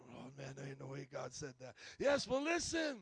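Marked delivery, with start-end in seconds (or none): 0.1-1.7 lower, softer scoffing voice; mock doubter. Pastor mocking a doubting member, low scoff. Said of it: "you might say".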